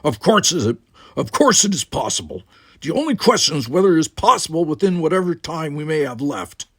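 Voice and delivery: forceful insistence. Acted. Firm insistence against despair.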